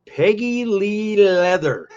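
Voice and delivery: slow, high, loud name announcement; ring announcer. Pretend. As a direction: draw the name out like a ring introduction. Announces the next wrestler's name.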